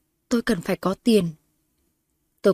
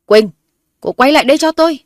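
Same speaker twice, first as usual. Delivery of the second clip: much higher, loud shout after her; calling loudly; 7 semitones higher, 9 dB louder than usual. A narrator's reading. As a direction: call her back, shouting. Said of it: "gọi lớn".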